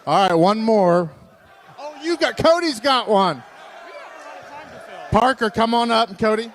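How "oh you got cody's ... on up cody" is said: calling out. Real, not acted. Calling kid to stage.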